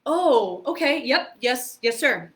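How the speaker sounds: mock panic, agreeing to everything at once; flustered eager yes. Newcomer told to run lines with Jackie, flustered eager yeses.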